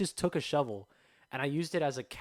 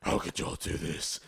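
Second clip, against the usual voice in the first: low, raspy Batman growl; 8 semitones lower than usual. Retold or imitated. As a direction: growl it like a caped vigilante. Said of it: "I was talking like Batman".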